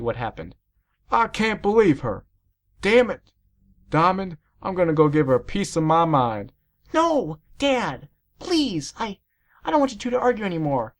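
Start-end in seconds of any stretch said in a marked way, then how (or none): 1.1-6.5 louder, high angry dad; outraged father. A narrator's reading. Outraged dad voiced in narration. 6.9-10.9 high, distressed begging; pleading child. A narrator's reading. Child begs dad not to argue.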